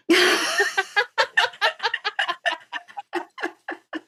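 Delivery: bright burst of high laughter. Real, not acted. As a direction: laugh high and long at your own joke. Laughing at her own joke.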